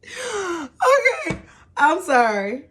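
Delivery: breaking down mid-sentence, catching herself; real crying. Real, not acted. Venting about her ex's wife, voice breaking into tears. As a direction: voice cracking high into tears, then an apology. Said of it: "Get yourself together, woman".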